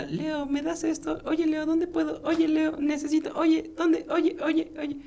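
high whiny nagging guest voice; pestering guests. Retold or imitated. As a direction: nag like needy party guests. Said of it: "ya todo era".

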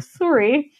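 mock Canadian "soo-ry"; elongated Canadian accent. Shown as a demonstration. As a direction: draw out the vowel of "sorry" like a Canadian. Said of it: "it's elongated like that".

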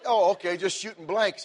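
high, loud easy reply; mock surprised relief. Father answering the instructor with loud relief. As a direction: act out an offhand relieved shrug.

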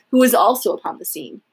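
plain reading voice

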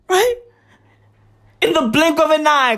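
ordinary talk

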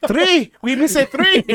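high, pleading worker voice; scared worker. Pretend. Worker answering his angry boss, high and pleading.

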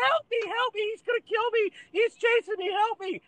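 very high-pitched fake panicked plea; mock distressed caller. Prankster tricking the 911 operator, mock panic in falsetto. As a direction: put on a high falsetto cry for help. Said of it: "I like that voice that you use".

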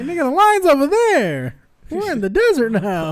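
high, loud disbelief; mock exasperated. Mocking a drunk to his face, high exasperated voice. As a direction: scoff at a drunk swaying off the line. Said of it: "It's like".

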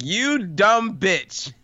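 high, drawn-out pretend outrage; mock pissed off. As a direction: stretch it out slowly in exaggerated indignation. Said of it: "I would have been so pissed".

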